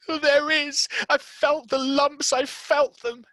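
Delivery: high, loud insistent whimper; cling to his fear; sick boy insisting on his lumps; frightened boy. Acted.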